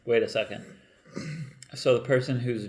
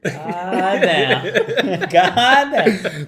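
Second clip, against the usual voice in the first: laughing; 7 semitones higher, 8 dB louder, much slower than usual. Real, not acted. Laughing at herpes joke.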